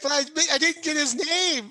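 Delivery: very high, loud plaintive protest; disappointed. Acted. Player as hero upset his attacker died nameless, disappointed. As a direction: whine it high, like a let-down hero.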